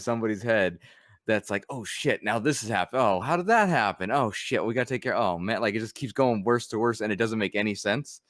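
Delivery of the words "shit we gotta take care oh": usual speaking voice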